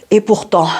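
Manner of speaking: plain reading voice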